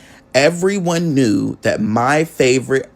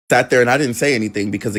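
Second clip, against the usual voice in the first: ordinary talk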